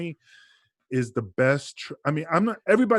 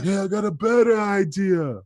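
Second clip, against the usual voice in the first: much higher mock trainer, proud pitch; trainer's better idea; 8 semitones higher, 5 dB louder than usual. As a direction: announce it like a stroke of genius. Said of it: "and Johnny's like".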